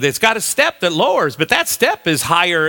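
ordinary talk

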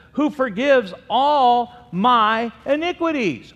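slow, high, loud proclamation; proclaiming. Acted. Preacher proclaiming the psalm, fervent and loud. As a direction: lift the verse up to heaven.

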